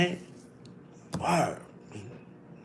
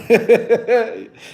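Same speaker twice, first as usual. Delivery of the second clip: higher-pitched giggling laugh; 4 semitones higher, 4 dB louder than usual. Real, not acted. Greeting the live chat, amused laugh. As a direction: break into a real giggle.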